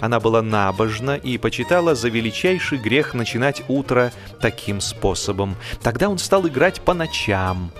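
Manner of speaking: usual speaking voice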